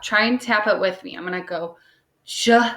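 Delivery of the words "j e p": each sound tapped apart; tapping out sounds. Shown as a demonstration. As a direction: tap out each letter sound. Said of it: "I'm gonna tap that out".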